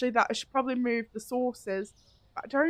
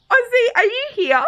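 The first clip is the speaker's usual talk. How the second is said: high, loud call to someone; raised, searching shout; 12 semitones higher, 9 dB louder than usual. Real, not acted. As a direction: call out to see if they're around. Calling out to a viewer.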